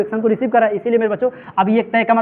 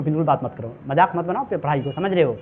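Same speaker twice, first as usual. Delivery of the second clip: lower, softer aside to the class; quiet admonishment; 7 semitones lower, 6 dB quieter than usual. Real, not acted. Teacher telling pupils to stop chatting, quiet admonishment. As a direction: drop the voice to tell pupils off.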